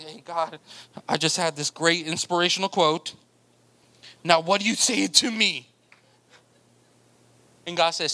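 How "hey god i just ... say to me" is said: lower, casual lightly voiced Moses; sheepish Moses. Moses asking God for approval, low and casual. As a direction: play it like a kid seeking praise.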